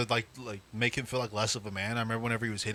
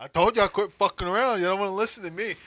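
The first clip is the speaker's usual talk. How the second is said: high, raised-voice gloating; smug scolding; 8 semitones higher, 4 dB louder than usual. Retold or imitated. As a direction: crow over them like a vindicated babysitter. Proud sibling gloating in story.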